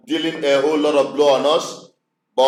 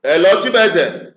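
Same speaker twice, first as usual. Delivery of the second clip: higher, louder straining to be heard; to someone hard of hearing; 5 semitones higher, 4 dB louder than usual. Real, not acted. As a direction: call out over a dead line. Caller cannot hear him.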